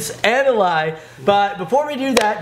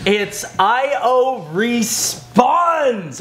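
announcer voice; 5 semitones higher, much slower than usual. Acted. Announcing the show title.

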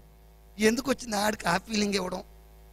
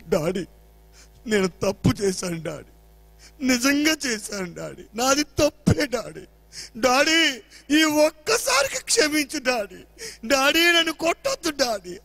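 imitated pleading cry; 5 semitones higher, 5 dB louder, slower than usual. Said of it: "అని ఏడుస్తున్నాడు".

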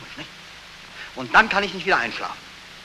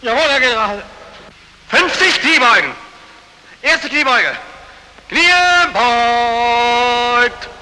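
drill commands; 5 semitones higher, much slower than usual. Acted. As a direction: count the squats like a drill sergeant. Calling out squat drill.

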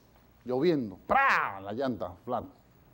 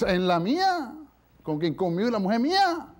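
loud, high insistence; emphatic host; 8 semitones higher, 7 dB louder than usual. Acted. Host insisting guest sleeps in his bed, loud and emphatic. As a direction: push the offer loudly, no arguing.

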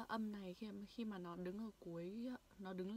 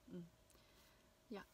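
ordinary delivery

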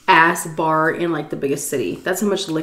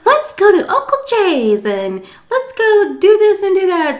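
put-on high, bright rallying voice for the kids; cheery dad; 13 semitones higher, 4 dB louder than usual. Dad gathering the kids to leave, high and cheerful. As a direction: herd the kids along cheerfully. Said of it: "So he's like".

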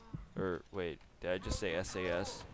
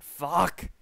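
loud, high curse; frustrated outburst; 6 semitones higher, 6 dB louder than usual. Real, not acted. Player just missed a shot, loud frustrated curse. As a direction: shout it out after a miss. Swears after missing a shot.

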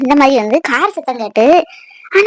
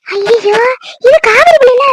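an aunt wails on seeing Kaveri after years, crying; tearful cry of recognition; 7 semitones higher, slower than usual. Acted. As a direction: cry out in shocked recognition at the sight of her. Aunt recognizes long-lost Kaveri.

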